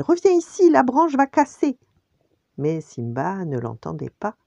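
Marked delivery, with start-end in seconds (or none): 0.0-1.8 high, loud urgent warning; alarmed shouting. A narrator's reading. Nala warning Simba the branch will break, shouting. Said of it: "cria-t-elle".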